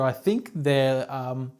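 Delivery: usual speaking voice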